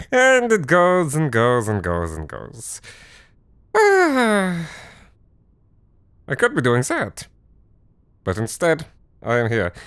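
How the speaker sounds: high, put-on showman voice of a grumpy Halloween host; eccentric German host. Reluctant Halloween host addressing the audience in a high put-on voice. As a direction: play the reluctant foreign host, camping it up.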